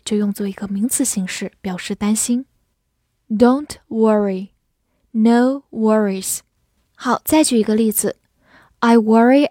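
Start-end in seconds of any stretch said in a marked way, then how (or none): 3.3-4.5 slow teaching read. Shown as a demonstration. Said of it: "我们慢读一遍". 5.1-6.4 slow teaching read. Shown as a demonstration. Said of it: "我们慢读一遍".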